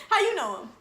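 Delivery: "How you know him?" said high and loud. Shown as a demonstration. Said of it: "Just like that".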